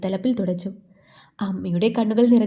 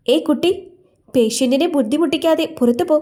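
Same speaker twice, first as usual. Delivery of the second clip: higher, louder irritated scolding; angry nurse; 6 semitones higher, 4 dB louder than usual. A narrator's reading. Nurse scolding the crying girl in the ICU, angry. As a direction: snap at the girl to get out. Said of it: "sister അവളോട് ദേഷ്യപ്പെട്ടു".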